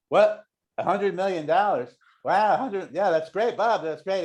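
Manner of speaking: high-pitched retold astonishment; amazed congratulation. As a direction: act stunned at someone else's windfall. Acting out friend's lottery win reaction.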